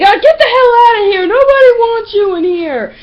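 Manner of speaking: high, loud, wailing yell; over-the-top retold shout; mock bawling outburst. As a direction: imitate a tantrum shouted across class. Imitates classmate's outburst in class.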